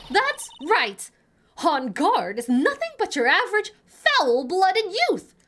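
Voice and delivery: loud, high, outraged rant; worked-up old busybody; excitable old lady. Acted. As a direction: denounce him with hammy venom. Plays the ranting witness.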